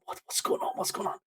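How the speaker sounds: imitating a puzzled crowd under the breath; whispered, retold audience murmur; hushed confusion. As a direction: keep it low and nearly voiceless, as if asking around.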